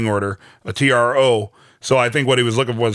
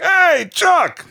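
a much higher, loud, hammed-up put-on accent; bad Jimmy accent; 4 dB louder than usual. Imitating Jimmy cheerfully greeting his brother, in a high hammed-up bad accent. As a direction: squeal his name like a cartoonish bad accent. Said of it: "in a really bad accent that I just did".

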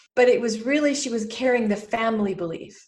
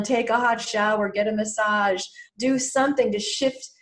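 ordinary talk

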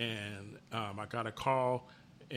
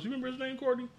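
ordinary delivery

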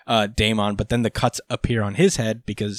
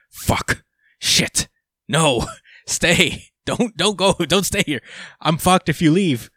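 mock dismay, climbing higher as the pleas go on; retold panic; 6 semitones higher than usual. Code begging Ada not to go, mock panicked. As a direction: plead in mild, hammed-up panic.

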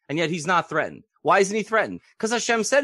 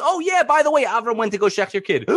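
high, loud breezy announcement; gossipy messenger; 9 semitones higher, 5 dB louder than usual. Retold or imitated. Messenger telling Sarah the news, high and breezy. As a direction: toss off the terrible news brightly.

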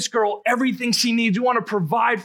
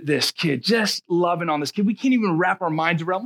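normal talking voice

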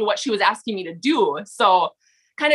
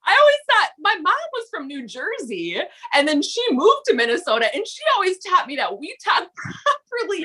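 amused telling; 7 semitones higher than usual. Real, not acted. Laughing through a story about mom.

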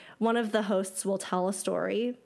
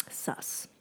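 soft, breathy suspicious whisper; whispered aside; 10 dB quieter than usual. Real, not acted. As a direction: whisper it like a secret. Whispered aside while reading.

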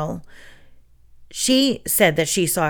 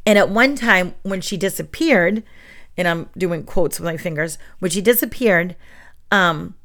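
ordinary delivery

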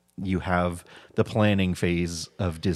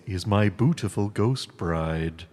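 ordinary talk, nothing marked

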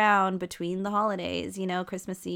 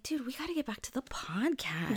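a whispered quote; 4 semitones higher, 8 dB quieter than usual. Retold or imitated. Recalling their plan to restart the podcast, whispered. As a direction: breathe it out as a secret resolution.

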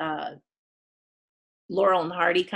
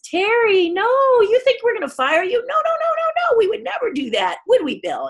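high, loud soothing reassurance; calm her down, nothing to fear; reassuring; 7 dB louder than usual. Retold or imitated. Dan Aykroyd reassures the shaking extra, high soothing.

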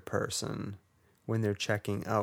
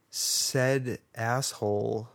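ordinary delivery

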